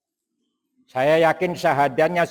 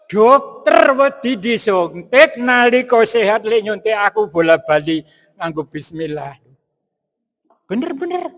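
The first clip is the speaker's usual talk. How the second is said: amused exclamation; 6 semitones higher, 5 dB louder than usual. Acted. Mocking the doctor afraid of injection.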